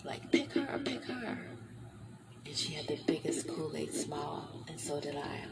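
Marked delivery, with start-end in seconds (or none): 0.3-1.4 high eager "pick her, pick her"; high excited urging whisper-shout; imitating Oprah urging. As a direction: mimic Oprah pushing for her.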